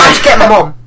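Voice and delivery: usual speaking voice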